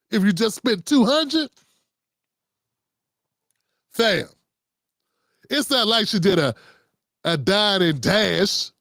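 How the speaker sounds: ordinary delivery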